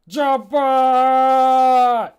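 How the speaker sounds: loud high shout of a name; calling out. Acted. Jammer calls Jobbot into the fight, loud high shout. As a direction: yell for the robot to come.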